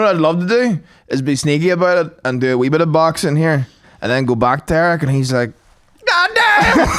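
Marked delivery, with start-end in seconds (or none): 6.1-6.9 high, amazed mock exclamation; impressed coach. Coach Eric reacting to improved boxing, mock amazed.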